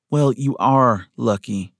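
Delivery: slow, emphatic declaration; declaring. A narrator's reading. Schoolteacher correcting her patient about his luck, declaring. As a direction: declare it firmly, taking your time. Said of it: "Miss Thompson declared".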